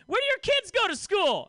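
high, loud, blunt interrogation; aggressive question. Retold or imitated. Comic grilling a single mom on a date, loud and high. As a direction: fire it off way too intensely. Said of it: "That's aggressive".

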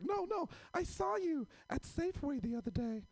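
put-on high, insistent kid voice; Ethan. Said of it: "And he was like".

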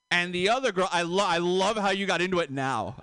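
ordinary delivery

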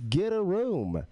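teasing, playful jab. Retold or imitated. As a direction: tease them lightly. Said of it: "in a very playful manner".

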